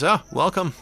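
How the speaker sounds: friendly host waving you in; cheerful camp host. Acted. GM voices Random again.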